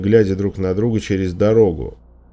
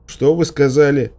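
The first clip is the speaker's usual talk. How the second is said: higher, raised-voice question; called-out question; 7 semitones higher, 3 dB louder than usual. A narrator's reading. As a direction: call back across a distance. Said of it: "крикнул я, задыхаясь".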